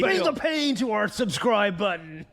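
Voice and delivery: high, slow, booming wrestler growl; wrestling promo. Acted. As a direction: hype the crowd like a wrestling announcer. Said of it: "my big wrestling impression".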